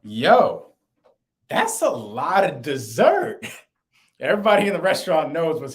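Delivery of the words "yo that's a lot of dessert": put-on drawn out, stunned girlfriend; slow mock amazement. Girlfriend sees the huge dessert plate, slow stunned drawl. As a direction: stretch it out, slowly, like you can't believe the plate. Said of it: "my girl just says".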